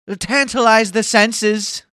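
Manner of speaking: high, loud, slow theatrical drawl; mock grand flourish. Streamer teasing viewers with a high, slow, hammed-up flourish. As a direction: savour each word like a showman.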